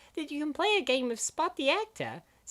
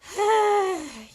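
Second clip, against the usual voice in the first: exasperated sigh; 4 semitones higher, 5 dB louder than usual. Acted. Groans at bad joke.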